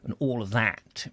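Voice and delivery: ordinary delivery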